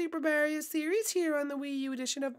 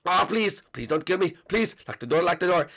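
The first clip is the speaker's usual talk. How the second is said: very low put-on voice, begging; pleading fan; 14 semitones lower than usual. Fan begging to lock the door, low pleading. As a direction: plead in a deep, panicky character voice. Fan begs for his life.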